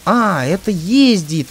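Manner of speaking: loud, slow, delighted discovery; excited surprise. Real, not acted. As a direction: blurt it out with sudden joy. Vehicle suddenly starts moving. (радостное удивление)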